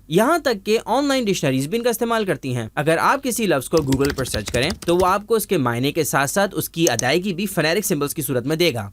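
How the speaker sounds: ordinary talk, nothing marked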